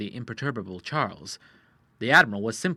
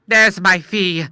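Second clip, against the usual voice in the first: slow, louder call for payment; cried out; 6 semitones higher, 12 dB louder, slower than usual. A narrator's reading. The doctor demanding his fee, a slow loud cry. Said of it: "cried the doctor".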